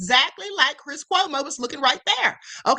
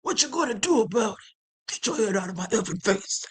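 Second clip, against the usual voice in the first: mock Italian tough guy; 6 semitones lower than usual. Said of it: "Cuomo sounded like a typical Italian".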